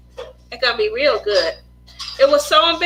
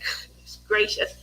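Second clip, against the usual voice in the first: hushed gasp; 4 semitones lower, 7 dB quieter than usual. Retold or imitated. Mom shocked, hushed.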